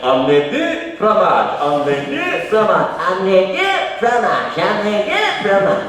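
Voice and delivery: stuck audio tape lightly voiced, pitch creeping up; looping tape. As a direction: imitate a stuck tape repeating. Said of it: "it just went".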